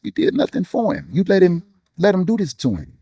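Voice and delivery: pitched high, pleading; anguished plea to God. Retold or imitated. Grieving father confronts God over his son, high and anguished. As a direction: throw the grief up at God, voice straining high.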